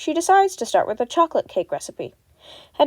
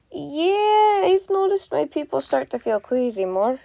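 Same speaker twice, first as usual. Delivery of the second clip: agreeing; 5 semitones higher than usual. Acted. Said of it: "Scott concurs".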